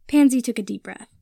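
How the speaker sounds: ordinary delivery, nothing marked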